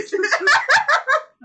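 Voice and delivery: loud, high cackling laugh; big high-pitched laughing fit; real laughter. Real, not acted. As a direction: burst out laughing helplessly. Cracks up at the imitation.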